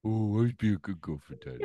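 put-on high, breathy puppy-girl voice; doggy voice. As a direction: coo it like a pet begging. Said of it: "the doggy voice".

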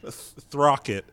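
THROCKET drawn out slowly and loudly; exaggerated enunciation. Shown as a demonstration. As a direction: stretch out the TH in Throcket. Exaggerates name so it is heard.

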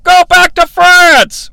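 hammed-up jeering commentator voice; mocking Gorilla. Commentator jeering at the Frenchman, high and loud. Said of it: "Gorilla's like".

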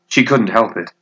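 ordinary delivery, nothing marked

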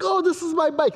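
high-pitched imitation of a delighted daughter; happy little girl. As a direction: mimic a kid thrilled with a present. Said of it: "She was so happy".

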